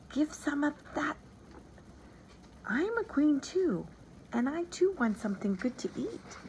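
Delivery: soft, high, eerie whisper; a hidden creature pleading from the shadows; whispering mouse queen. A narrator's reading. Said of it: "a faint whisper was heard".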